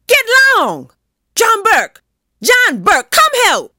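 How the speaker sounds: very high, loud yells for help; crying out. A narrator's reading. Old woman under attack calling John Burke, crying out. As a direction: holler in alarm for someone to come.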